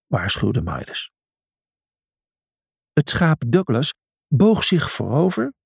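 plain reading voice

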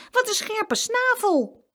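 high, drawn-out complaint; pained exclamation. A narrator's reading. Boy complaining about a hen's peck, pained exclamation.

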